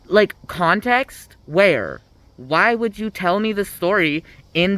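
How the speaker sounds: high, drawn-out, incredulous question; exasperated disbelief. Real, not acted. Ranting at the book, baffled, asking for context. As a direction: demand context, baffled and fed up. Ranting about the story's order.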